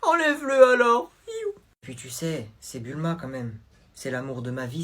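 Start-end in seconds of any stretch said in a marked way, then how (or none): 0.0-1.1 high, tearful demand; mock crying. Girlfriend ordering him to take off the sweater, crying. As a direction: demand it through tears.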